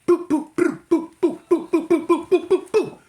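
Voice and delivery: high, loud punching noises; punch sound effects. Retold or imitated. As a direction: rattle off the punches like a cartoon.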